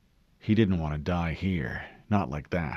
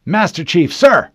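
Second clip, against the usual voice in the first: much higher, louder call to attention; saluting shout; 10 semitones higher, 13 dB louder than usual. A narrator's reading. Corporal greets the Master Chief, loud and high. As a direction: bark it out at attention.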